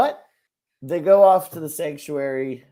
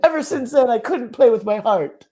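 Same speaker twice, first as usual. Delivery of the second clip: hammed-up sob, pitched high; fake-cry it loudly; mock crying; 11 semitones higher, 8 dB louder than usual. Mock tearful confession.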